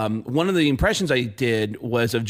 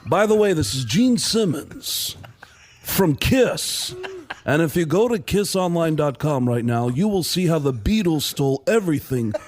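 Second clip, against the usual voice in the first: self-promoting pitchman impression. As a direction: butt in with a deliberate, emphatic sales plug. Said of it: "interrupt him as [name]".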